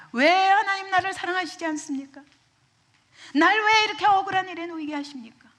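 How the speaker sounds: high, loud acted plea to God; anguished complaint. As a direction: cry out in protest at being wronged. Acted protest of a believer to God. (억울해하며 높고 크게 따져 묻듯)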